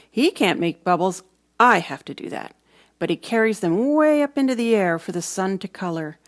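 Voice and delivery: usual speaking voice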